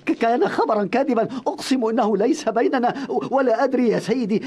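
stammering in fear; fearful stutter. Acted. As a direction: stumble over words, terrified. Frightened servant stammers to emir. (تلعثم من الخوف)

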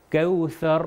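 kawthar with tongue-tip tha; measured model reading, tha between the teeth; clear Arabic tha. Shown as a demonstration. Teacher reads for learners to repeat, clear tha in kawthar. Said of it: "سا کا تلفظ جو ہے".

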